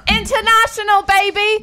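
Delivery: high hyped-up cheer; excited shout. Real, not acted. Hyped shout, called loud.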